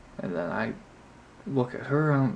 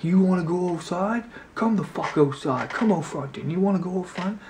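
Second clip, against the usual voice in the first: higher, louder imitation of a guy picking a fight; mock aggressive challenge; 7 semitones higher, 5 dB louder than usual. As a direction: replay his taunt, a touch hammed-up. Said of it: "And he comes back".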